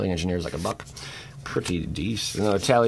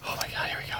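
ordinary delivery

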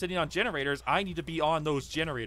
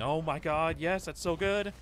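ordinary delivery

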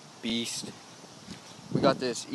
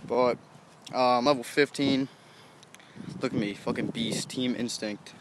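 usual speaking voice

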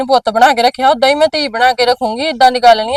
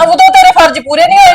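sharp high cry of reproach; bitter accusation; 3 dB louder than usual. Acted. Daughter accusing mother of neglecting Sabi, bitter. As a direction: throw her duty back at her. Angry accusation in family quarrel.

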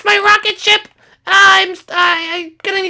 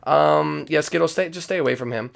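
much lower, quicker character voice; Forehead voice; 8 dB quieter than usual. Acted. Forehead greeting Skittles, low deep voice. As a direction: rumble it deep like a big guy.

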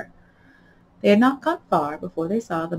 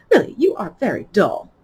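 angrily; 3 semitones higher, 7 dB louder than usual. A narrator's reading. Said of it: "said the Mock Turtle angrily".

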